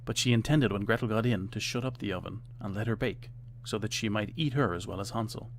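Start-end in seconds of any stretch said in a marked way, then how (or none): none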